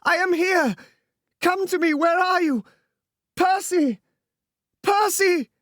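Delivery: frantic high call across the beach; hysterical shriek. Acted. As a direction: scream for him in desperate hope. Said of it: "she shrieked hysterically".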